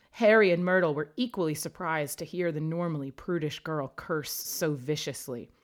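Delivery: ordinary delivery, nothing marked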